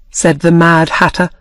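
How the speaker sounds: usual speaking voice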